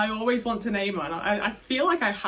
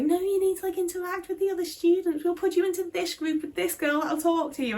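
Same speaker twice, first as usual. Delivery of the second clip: high-pitched, bossy teacher imitation; mock strict teacher; 7 semitones higher than usual. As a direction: mimic a pushy teacher lecturing the kid. Teacher insisting on group work.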